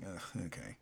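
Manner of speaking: low, quiet grudging groan; deflated resignation. Retold or imitated. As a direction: let it sink into a resigned mutter. Retold let-down reaction to ending.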